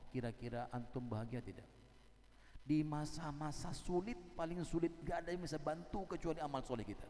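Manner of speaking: normal talking voice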